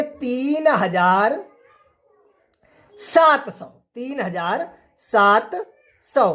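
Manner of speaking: slow, spelled out: तीन हजार सात सौ; slow dictation. Shown as a demonstration. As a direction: dictate तीन हजार सात सौ slowly, like a teacher writing on the board. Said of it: "ये आपका राइट है". (धीरे-धीरे बोलकर लिखवाना: तीन हजार सात सौ)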